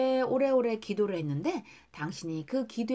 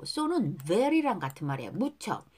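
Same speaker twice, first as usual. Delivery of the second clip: ordinary talk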